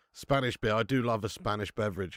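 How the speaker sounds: ordinary delivery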